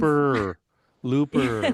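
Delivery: LOOPER said loudly with a stressed R ending; teasing "Looper" with a hard American R; exaggerated R. Shown as a demonstration. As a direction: say "Looper" loud, leaning on the final R. Another host teases the pronunciation.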